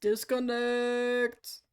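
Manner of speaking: drawn out slowly; slow drawn disconnect. Real, not acted. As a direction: stretch each syllable. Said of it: "that tone of voice".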